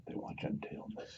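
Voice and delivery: barely breathed whisper; soft demo whisper. Shown as a demonstration. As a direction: whisper it to the candle. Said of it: "very softly".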